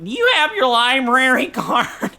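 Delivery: high, slow, drawn-out little voice cracking into laughter; cute little old-man voice. Retold or imitated. Librarian asking visitors for their card, cute little voice. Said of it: "cutest little voice in this old guy".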